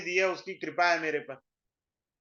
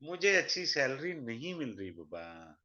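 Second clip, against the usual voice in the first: low, soft mimicry of a grumbler; mock plaintive complaint; 4 semitones lower, 5 dB quieter than usual. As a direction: sink into a mock-helpless plea. Mimics job-hopper complaining to a baba.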